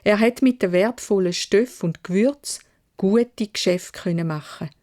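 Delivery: ordinary delivery, nothing marked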